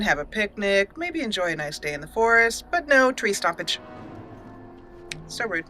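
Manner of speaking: ordinary delivery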